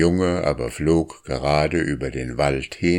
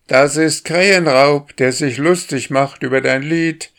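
high, loud teasing call; 9 semitones higher, 5 dB louder than usual. A narrator's reading. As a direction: shout it down cheekily. Boy calls down to bird.

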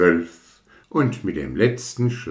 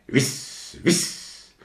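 whoosh; 7 semitones higher than usual. Acted. Fireworks whooshing in story.

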